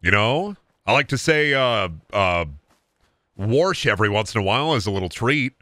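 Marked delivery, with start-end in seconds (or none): 3.4-3.9 "warsh" with an r before the sh; Midwestern warsh. Shown as a demonstration. Confessing an Iowa habit, "warsh" with added r. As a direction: slip an r into wash: "warsh". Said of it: "I say a couple words weird".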